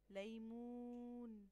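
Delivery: slow loud demo of ليمون; loud drawn-out model word. Shown as a demonstration. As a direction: stretch ليمون for the class. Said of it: "ليمون الحرف الممدود هنا".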